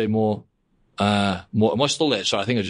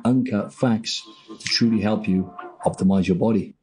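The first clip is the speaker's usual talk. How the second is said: slow, announcer-style show opening; podcast host intro; slower than usual. Acted. Host opening the show for listeners, slow announcer read. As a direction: welcome listeners like a radio host.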